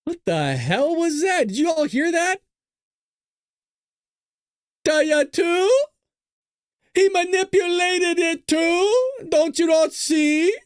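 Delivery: high, loud, drawn-out squawk; hammed-up screechy disbelief; mocking imitation. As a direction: mimic an over-the-top rally speaker, stretched and high. Mocking the shouted speech in clip.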